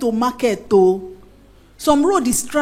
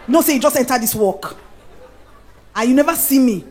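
ordinary talk

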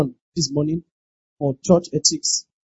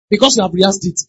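ordinary talk, nothing marked

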